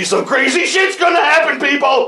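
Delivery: high, shouted promise to listeners; excited hype. Real, not acted. Host hyping listeners for the return after hiatus, excited shout. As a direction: whoop it up for the comeback.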